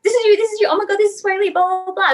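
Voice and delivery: high, squealing mock excitement; excited friends teasing. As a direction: imitate friends gushing over the clip. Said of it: "they're just like".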